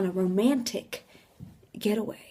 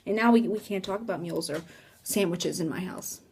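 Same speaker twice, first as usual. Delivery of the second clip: ordinary delivery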